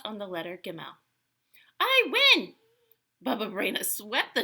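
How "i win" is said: high, loud crow of victory; triumphant cry. Acted. Baba Braina wins at dreidel, triumphant cry. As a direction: call out the win in delight.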